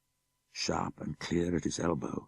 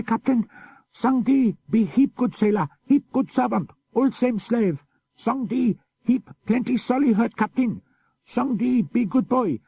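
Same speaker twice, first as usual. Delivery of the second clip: much higher, louder desperate pidgin begging; pleading for mercy; 7 dB louder than usual. Acted. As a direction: beg for your life in broken pidgin, terrified. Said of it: "He cried to the captain in his pidgin for mercy".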